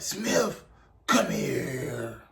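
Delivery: mock drill sergeant summons, not shouted; Drill Sergeant Kickass. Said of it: "Kickass saw me. He was like".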